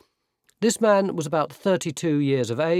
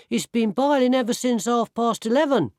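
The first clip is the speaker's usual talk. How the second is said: higher, lighter voice of a weedy lad; boy apprentice voice; 10 semitones higher than usual. Acted. Boy apprentice defending his tea to the labourer, higher lad's voice. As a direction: play the frail boy sticking up for his tea. Apprentice Bert answering back.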